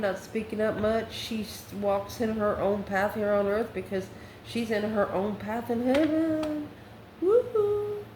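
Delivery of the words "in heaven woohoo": slow, rising sing-out into a high whoop; gleeful. Real, not acted. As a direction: stretch it upward and cheer. Cheering the card's heavenly meaning.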